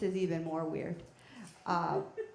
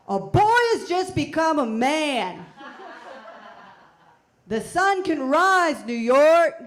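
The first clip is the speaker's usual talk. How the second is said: slow, loud, higher triumphant proclamation; proclaiming yell; 7 semitones higher, 13 dB louder, slower than usual. Retold or imitated. Yelling out the window to New York, loud and triumphant. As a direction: bellow it out the window like a town crier. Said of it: "wave it outside the window and yell".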